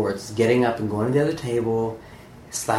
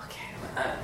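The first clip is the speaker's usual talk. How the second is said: a breathy unvoiced gathering of nerve; whispered resolve; 12 dB quieter, much faster than usual. Steeling himself to approach the author, whispered. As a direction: whisper it to psych yourself up. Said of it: "I was like".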